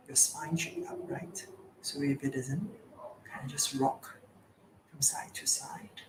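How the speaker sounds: very soft, hushed meditation guidance; guided meditation. Real, not acted. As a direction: ease them gently into stillness, with pauses.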